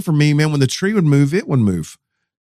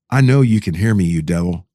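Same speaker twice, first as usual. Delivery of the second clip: low, steady re-enacted challenge; retold defiance, controlled and unafraid; challenging; 6 semitones lower than usual. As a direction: confront an enemy, dropping low at the end. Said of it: "said it in that tone of voice".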